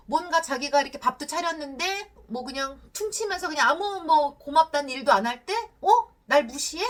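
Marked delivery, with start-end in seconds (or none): 5.8-6.8 mildly offended retort; offended anger. Acted. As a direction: act out a touchy father taking offence. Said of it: "그러니까 화를 내셨던 거예요". (살짝 욱해서 따지는 말투)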